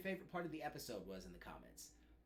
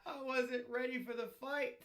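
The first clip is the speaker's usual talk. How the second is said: laughing; 9 semitones higher, 4 dB louder than usual. Real, not acted. Still laughing after the scene.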